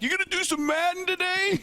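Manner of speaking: high, eager fan voice asking for an impression; excited fan. Fans outside the show asking Frank, in a high eager fan voice. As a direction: play a hopeful fan begging for the bit. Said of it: "they're like".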